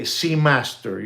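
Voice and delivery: normal talking voice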